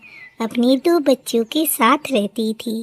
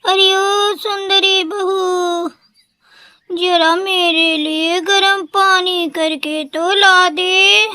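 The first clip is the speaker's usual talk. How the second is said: put-on higher, slow, stretched-out call of a frail old crow; drawn-out call; 6 semitones higher, slower than usual. A narrator's reading. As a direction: call out long across the house, sick and needy. Calls again, drawn out. (बूढ़ी कौवी की धीमी, ऊँची, खिंची हुई पुकार)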